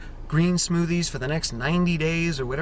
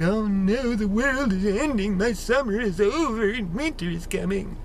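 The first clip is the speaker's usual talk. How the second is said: melodramatic whine; 6 semitones higher than usual. Retold or imitated. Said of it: "and we're like".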